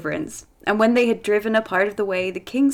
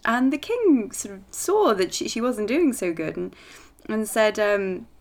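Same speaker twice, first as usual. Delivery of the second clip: usual speaking voice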